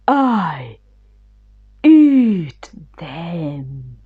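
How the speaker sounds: a lion growling a threat. Acted.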